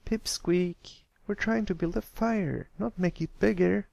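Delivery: high, exasperated filly; a fed-up groan at the little one; Scootaloo, groaning. Acted. Scootaloo groaning at Pipsqueak's board, high exasperated filly voice. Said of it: "Scootaloo groaned".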